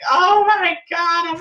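high, loud, drawn-out fake weeping; mock sobbing. Host mocking wrestler weeping over injury, hammed-up sobbing. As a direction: wail it out as an exaggerated sob. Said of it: "like sobbing, weeping".

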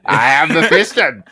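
high, loud sneering narrator voice; sneering Mephiston. Retold or imitated. Friend mocks the audiobook Mephiston with a sneer. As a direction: sneer out every grand title. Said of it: "this weird sneer all the time".

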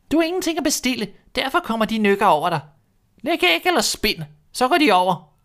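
high, bossy hen voice; a fussy hen nagging; hen's voice. A narrator's reading. Hen nagging the restless duckling, high and bossy.

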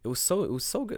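ordinary talk

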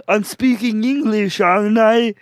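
high, loud mock Austrian accent. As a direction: ham up a frustrated Austrian accent. Mock Arnold accent, frustrated.

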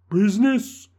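high, loud outraged cry. Acted. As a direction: fling the word back. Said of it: "cried the ghost".

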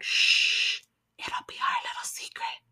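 put-on whispered creepy old lady; creepy secret whisper. Grandma whispering to the girl to keep it secret. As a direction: hush her like a sinister grandma.

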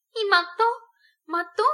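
high, loud appalled exclamation; horrified outcry. A narrator's reading. Sonia horrified that he killed, crying out. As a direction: cry out aghast.

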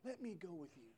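soft, pleading whisper of a man begging. Retold or imitated. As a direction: beg quietly, almost a whisper. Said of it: "The Bible says he begged".

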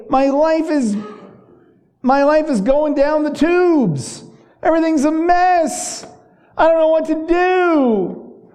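a preacher acting a despairing psalmist; voicing David's despair. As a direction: cry out like a man at the end of his rope. Preacher voices depressed David.

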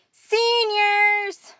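high, loud, sustained summons; shouted roll call. A narrator's reading. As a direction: call out the class across the hall. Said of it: "Mora continued, slightly quieter".

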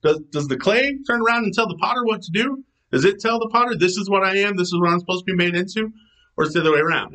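mock disbelief at a clay pot talking back; a teasing God asking pointed questions. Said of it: "He's like".

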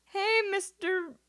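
high, slow, drawn-out sheepish hello; sheepish greeting. Retold or imitated. Teen greeting her dad's cop friend, high and sheepish. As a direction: sing it out like a kid caught red-handed.

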